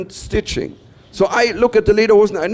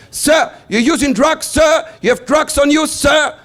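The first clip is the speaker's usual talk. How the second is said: a hint of a barking customs officer, louder and higher; officer interrogation; 8 semitones higher, 3 dB louder than usual. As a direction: accuse him like a stern border officer. Acts customs officer accusing him.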